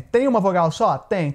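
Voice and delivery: ordinary talk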